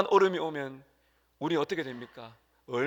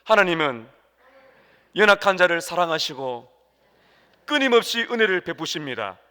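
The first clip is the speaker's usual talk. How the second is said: louder, deliberate line for the congregation to echo; call to repeat; 7 dB louder than usual. Shown as a demonstration. 따라 하도록 크게 선창. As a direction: pastor leading the congregation in a repeat-after-me line, loud. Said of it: "한번 따라 하실까요?".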